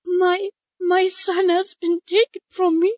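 pleading bird mother. Acted. Bird mother begs the adventurers to find her son.

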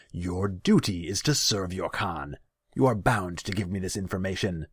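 stern command. A narrator's reading. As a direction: command as an offended khan. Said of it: "he softened his tone".

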